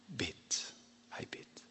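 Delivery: hushed whisper of the word. Real, not acted. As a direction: drop to a bare whisper. Whispered reveal of prayer.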